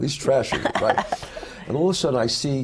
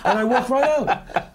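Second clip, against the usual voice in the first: higher and louder, laughing through the words; laughing triumph; 9 semitones higher, 3 dB louder than usual. Real, not acted. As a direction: savor the victory, laughing.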